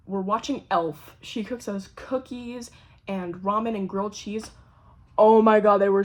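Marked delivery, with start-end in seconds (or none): 5.2-6.0 a loud burst of delight; excited outburst. Real, not acted. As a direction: blurt it out over how good it was. Raving about the food.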